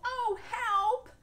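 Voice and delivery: panicked cry. Acted. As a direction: scream to be rescued. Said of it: "she called".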